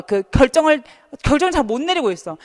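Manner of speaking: ordinary talk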